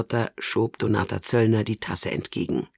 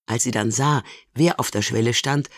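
usual speaking voice

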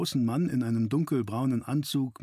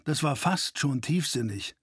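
ordinary delivery, nothing marked

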